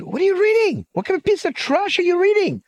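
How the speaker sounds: high, indignant outburst at a friend; outraged friend. Retold or imitated. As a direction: scold him, shocked and disgusted. Old friend scolds over racist paper.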